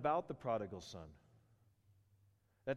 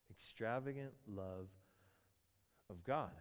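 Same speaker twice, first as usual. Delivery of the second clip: softer repeat, letting "Extravagant" sink in; repeated quietly; 4 semitones lower, 7 dB quieter than usual. Shown as a demonstration. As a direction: let it settle, almost hushed. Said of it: "but that phraseology".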